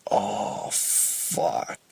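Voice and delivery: a hushed, sinking oh fuck, whispered; dismayed whisper. Retold or imitated. Kid who knocked over the ornaments, whispering in dismay. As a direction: breathe it out like you just broke everything.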